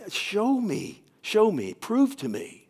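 insistent, high-pitched mock plea; retold pleading. Pastor praying at home to the Lord, retold urgent pleading. Retold desperate prayer to God.

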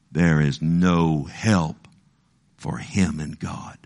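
"There is no help for him in God" said low and slow; mocking doubters. Preacher voicing the enemies who taunt David, low and slow. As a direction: drop low and drag it out like a scoffer.

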